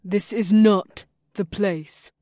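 slow, high warning voice. A narrator's reading. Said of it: "had a warning tone in it".

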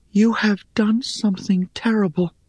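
high-pitched grave accusation; Rhea, accusing. A narrator's reading. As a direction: accuse a friend in a high character voice.

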